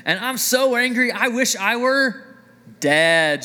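mock tantrum with a laugh, slow wail on the last word; melodramatic anger. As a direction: play Jonah as an over-the-top drama queen. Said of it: "he said".

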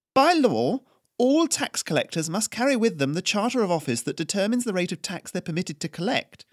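cool aristocratic challenge; imperious noblewoman Haryana. Acted. Noblewoman Haryana challenges the tax collector, imperious and crisp.

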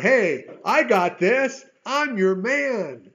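loud, slow, swaggering brag; cocky boast. Pretend. Preacher mocking proud reply, slow loud swagger. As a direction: brag like you've got it covered. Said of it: "No, none of that stuff. That's all pride".

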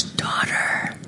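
"Daughter," breathed in a soft whisper. Acted. Jesus calling the woman, whispered "Daughter,".

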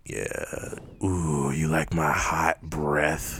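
slow, low, hammed-up sultry purr; slow low mock-seductive drawl. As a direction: tease like a bad phone-sex voice. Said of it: "This is getting weirdly sexual".

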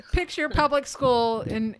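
usual speaking voice

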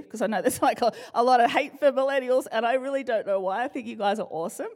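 usual speaking voice

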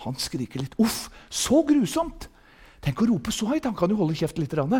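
hushed, mildly disapproving mock complaint; soft imitation of offended churchgoers; imitated grumblers. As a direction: mimic prim complainers under your breath. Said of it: "Det er noen som sier".

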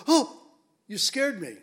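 sudden loud high "Oh" of fright, then easing; imitating a startled woman at the tomb; startled cry. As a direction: jump as if caught off guard. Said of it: "Can you imagine them going".

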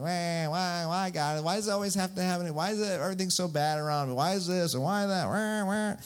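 high, rapid whining; hammed-up, rushed complaint dissolving into wails; Caillou. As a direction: whine at God like a cartoon brat. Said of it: "have that Caillou moment".

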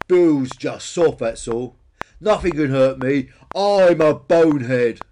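loud, slow self-assurance; boastful bonehead Peter. A narrator's reading. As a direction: declare your toughness, swelling to the end.

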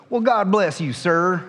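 cheerful Southern delivery man; delivery man's blessing. Retold or imitated. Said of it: "and he goes".

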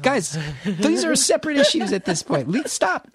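high, loud exasperated pleading; exasperated plea. Retold or imitated. Comedian to arguing crowd, high exasperated plea. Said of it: "I'm like".

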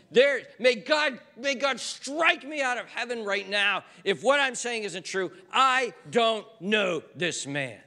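a cornered Peter yelling his denial, high and loud; shouted oath. Retold or imitated. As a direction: shout the vow to heaven. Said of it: "He is saying".

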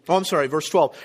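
ordinary talk, nothing marked

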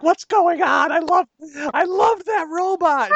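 high, wailing mom voice; tearful mock wail; imitating crying mom. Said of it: "she's like".

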